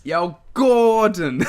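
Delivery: drawn-out, loud mock Italian-American call; Italian-American accent. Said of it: "you have to commit to the accent".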